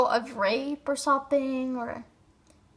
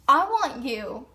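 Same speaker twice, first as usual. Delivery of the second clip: usual speaking voice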